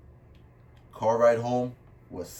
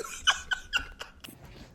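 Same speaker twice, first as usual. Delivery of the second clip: high mock cackle; 6 dB quieter than usual. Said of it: "put it on right here".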